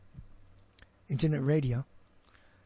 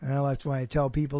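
usual speaking voice

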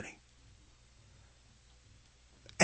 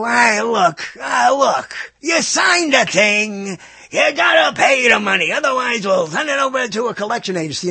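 pushy company rep; 6 semitones higher, 4 dB louder than usual. Acted. Company rep to a customer behind on payments, pushy. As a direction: lean on the customer, loud and high.

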